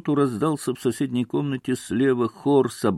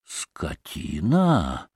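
low, drawn-out insult with a swoop; growing contempt, dragged out slowly; understated angry cry; outraged insult; 8 semitones lower, 5 dB quieter, much slower than usual. A narrator's reading. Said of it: "заорал было пан Врублевский".

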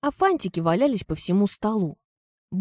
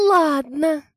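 sulky; 7 semitones higher, 4 dB louder than usual. Acted. Teen giving in sulkily.

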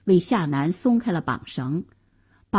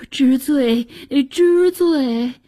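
hurried-to-please, higher submissive voice; cowed confession; 4 semitones higher, slower than usual. A narrator's reading. Frightened chancellor kowtows to his accuser, cowed confession. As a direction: grovel as you admit guilt.

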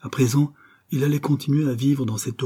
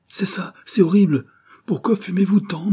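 higher-pitched indignant exclamation; disgusted complaint; 10 semitones higher, 4 dB louder than usual. A narrator's reading. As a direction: protest at a horrible smell, voice raised in pitch. Acted complaint about tobacco smell.

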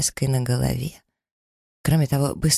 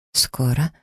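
held-back annoyed echo of Скоро; Скоро as a tight, rising echo; suppressed irritation. A narrator's reading. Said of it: "эхом повторила я, с трудом сдерживая раздражение".

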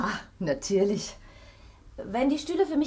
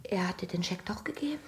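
breathless whispered disbelief; stunned whisper; 3 semitones lower, 7 dB quieter than usual. Acted. Wife learns her husband lied to her, stunned whisper. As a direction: whisper it, unable to believe it.

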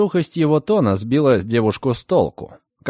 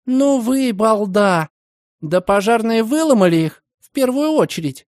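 put-on exasperated young woman; 8 semitones higher than usual. A narrator's reading. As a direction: scold him in disbelief. Margaret scolds him. (возмущённо, громко)

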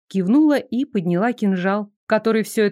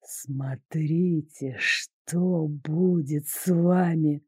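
low, slow, hissing spectral threat; ghost voice; 6 semitones lower, 5 dB quieter, much slower than usual. A narrator's reading. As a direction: menace them like a vengeful ghost. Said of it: "не унимался призрак".

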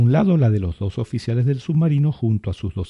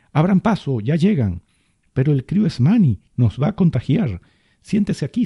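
crowd shouting; 6 semitones higher than usual. A narrator's reading. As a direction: shout to clear the way. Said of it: "con gente gritando cosas como".

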